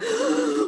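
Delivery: high gasp of mock delight; mock excited gasp. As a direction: gasp like a kid at a present. Imitating dad opening the box.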